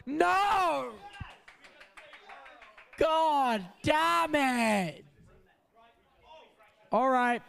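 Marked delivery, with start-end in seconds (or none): 0.1-5.0 frustrated shout. Real, not acted. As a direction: yell it out in frustration. Wheel spin lands badly.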